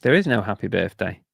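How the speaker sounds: normal talking voice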